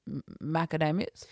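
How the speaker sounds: mumble-start "M-", then long "DAY" in "macadamias"; "macadamias" said the standard way, stammered; dad's switched pronunciation. Retold or imitated. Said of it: "Dad walks in".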